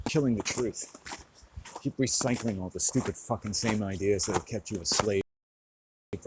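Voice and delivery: loud, emphatic tirade of orders; angry commands. Real, not acted. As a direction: hurl each order with force.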